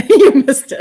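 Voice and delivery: high, loud laugh into the words; real laugh, teasing a viewer; laughing tease. Real, not acted.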